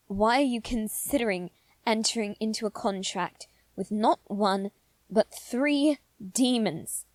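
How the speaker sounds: "three" slowed and leaned on; exasperated stress. Acted. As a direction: hammer home how many demons. Scolding disbelief.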